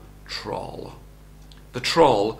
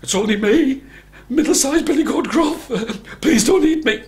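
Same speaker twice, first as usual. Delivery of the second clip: high, loud, panicky goat voice; terrified little billy goat; frightened middle goat; 11 semitones higher, 7 dB louder than usual. A narrator's reading. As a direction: squeal for mercy at the troll.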